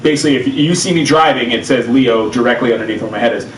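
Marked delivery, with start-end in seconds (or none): none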